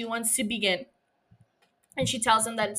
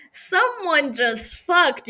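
slow, higher, louder knowing remark; knowing sniff; 7 semitones higher, 7 dB louder, much slower than usual. Pretend. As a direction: savor it like you caught them. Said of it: "and you're like".

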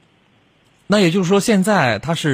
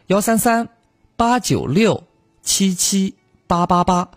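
each digit 八 said slowly and clearly, one by one; slow dictation; 6 semitones higher, slower than usual. Shown as a demonstration. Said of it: "幺三三八九六七七八八八就可以了".

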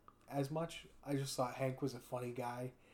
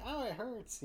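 put-on high whining kid voice, pitched well up; whiny little girl; 10 semitones higher than usual. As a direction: whimper it like a spoiled child. Voicing the niece Angelica.

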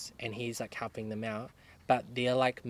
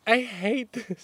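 retold whine; 9 semitones higher, slower than usual. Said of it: "And I was like".

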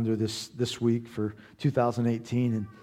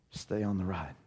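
lower, softer, earnest; encouraging; 4 semitones lower, 8 dB quieter than usual. Real, not acted. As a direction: press it gently home. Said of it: "Can I just encourage you".

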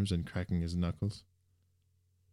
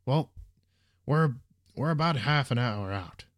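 halting, higher-voiced answer with gaps; stumbling; 6 semitones higher, 3 dB louder than usual. A narrator's reading. Driver realizes his last answer was silly, stumbling. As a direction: trip over the opening words, then finish. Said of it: "Stumbling on his words".

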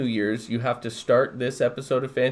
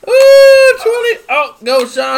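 high, loud cheers; excited high cheering; 13 dB louder, slower than usual. Real, not acted. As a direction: cheer him on. Cheering the bottle opening.

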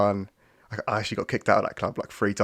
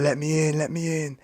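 higher, insistent mock shout at the door; mock pleading yell; 7 semitones higher than usual. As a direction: bang on the door and beg, pitched up. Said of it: "She's like".

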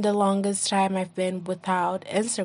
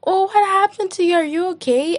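high, concerned gushing; mock worried friend; 10 semitones higher, 4 dB louder than usual. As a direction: gush with fake worry. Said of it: "it's like".

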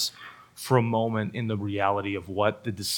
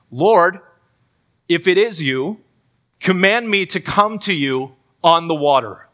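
raised, higher bold plea, reading Peter's words; 5 semitones higher, 6 dB louder than usual. Retold or imitated. Peter calls out to Jesus on the water, raised and bold. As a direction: call out eagerly across the water. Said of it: "Peter answers him".

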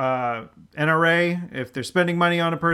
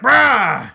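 loud, raised-pitch bear roar; 5 semitones higher, 8 dB louder than usual. Acted. Bear shot with the elephant gun, loud roar. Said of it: "The bear's like".

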